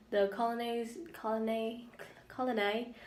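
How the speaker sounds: accidental British accent on colony. Real, not acted. Reader stuck on a word, slipping into a British accent. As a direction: let colony slip into British, ending in "ay". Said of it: "I have a British accent now".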